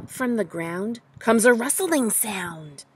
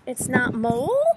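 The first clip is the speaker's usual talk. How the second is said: ordinary delivery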